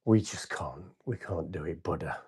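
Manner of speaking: soft, low, giving-up murmur; retold plea, voice sinking away quietly; defeated plea. Frightened monks telling the Buddha they can't meditate there, defeated plea.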